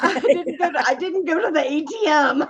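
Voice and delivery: high, real laughter through the words; can barely get it out for giggling; laughing. Real, not acted. Telling the fireman story on air: high, laughing.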